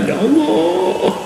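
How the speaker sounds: high, slow whining cry; mock wail. Broke worshipper crying to God, high mock wail. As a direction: whine it out in fake grief.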